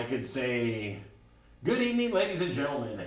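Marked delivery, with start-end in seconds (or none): 1.6-3.0 quick, higher, bright club-MC announcement; DJ announcer voice. Shown as a demonstration. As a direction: hype the room like a bar DJ on the mic. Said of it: "It sounded good on the microphone".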